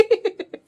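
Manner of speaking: loud, high burst of laughter; laughing. Real, not acted. Cook amused by her own shredding, laughing out loud.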